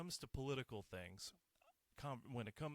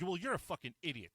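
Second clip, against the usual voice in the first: angry insult; 5 semitones higher, 8 dB louder, faster than usual. Pretend. Acted insult shutting someone down.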